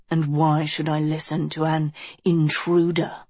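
ordinary delivery, nothing marked

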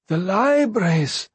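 high, slow, stunned echo; faint. A narrator's reading. Beloved learns the libraries are burning, speaking faintly. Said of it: "Beloved said faintly".

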